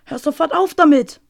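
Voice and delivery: loud, sharp call to stop; shout. A narrator's reading. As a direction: shout it out in pain. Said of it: "wollte Otto rufen". (lauter Ruf)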